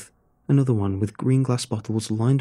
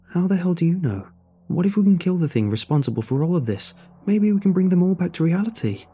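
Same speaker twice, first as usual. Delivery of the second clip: raised, higher-pitched challenge; agitated protest; 8 semitones higher, 3 dB louder than usual. A narrator's reading. Narrator challenging ally that victims may still be saved, agitated. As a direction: push back urgently, pitched up.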